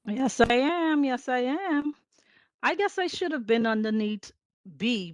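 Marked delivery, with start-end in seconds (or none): none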